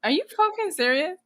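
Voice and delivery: high incredulous retort. Retold or imitated. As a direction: scoff in disbelief at a friend. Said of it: "I'm like".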